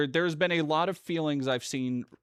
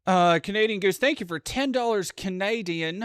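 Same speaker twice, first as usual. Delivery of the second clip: put-on half-hearted Canadian accent, higher and louder; a failed stab at a Canadian accent, pitched up and loud; 8 semitones higher, 6 dB louder than usual. Thanking donor Canadian Goose, in a mock Canadian accent. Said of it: "that's not a Canadian accent".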